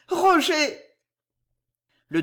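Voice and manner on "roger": high, loud exclamation of his name; acted high-pitched cry of recognition; startled cry. A narrator's reading. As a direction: cry out to an unexpected beloved. Lover unexpectedly arrives, cries his name.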